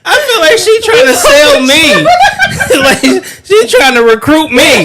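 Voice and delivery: high, loud laughing talk; laughing hard. Real, not acted. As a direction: crack up while talking. Laughing at sales pitch.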